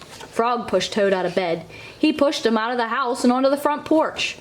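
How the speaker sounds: ordinary delivery, nothing marked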